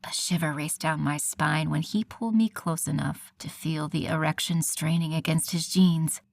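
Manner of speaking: usual speaking voice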